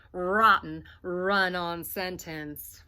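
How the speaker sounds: plain reading voice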